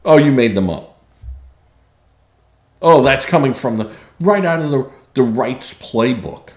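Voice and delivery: mock dismissal. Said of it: "when you show them facts".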